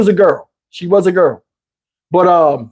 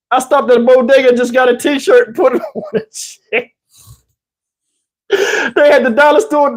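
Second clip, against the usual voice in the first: laughing retelling; 5 semitones higher than usual. Real, not acted. Laughs recounting the t-shirt.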